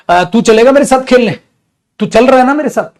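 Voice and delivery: friend's invitation. Acted.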